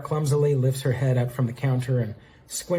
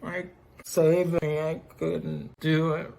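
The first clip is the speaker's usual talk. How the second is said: put-on slow, drunken stumbling speech; tongue-tripping slur; 4 semitones higher, 3 dB quieter, slower than usual. A narrator's reading. Ruby shakes Amingo as she speaks, slurring drunkenly. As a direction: trip over your tongue, dragging the words out. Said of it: "tripping over her own tongue".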